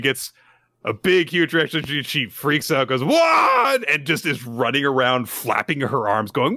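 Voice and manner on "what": high, loud shocked "What?"; little girl freaking out in shock; shocked squeal. Retold or imitated. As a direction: squeal it in disbelief. Anya freaks out.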